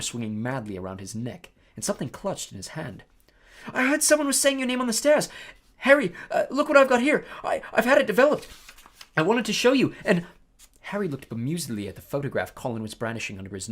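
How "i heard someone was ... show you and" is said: put-on high, loud eager boy voice; excited young boy. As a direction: gush like an excited kid. Colin excitedly rushing to Harry.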